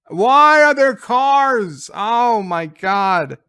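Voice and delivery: loud, slow, high exasperated cry; why are there cars, yelled in disbelief; exasperated outburst. Real, not acted. Reviewer yelling at cars in trailer, exasperated.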